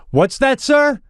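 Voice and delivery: high-pitched challenging question; imitated curt coach. Coach challenges a parent who called down, imitated officious query.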